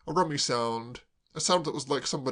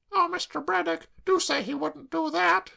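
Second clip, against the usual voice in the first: put-on tearful girl; 3 dB louder than usual. Claire sobbing to Braddock, high tearful wail.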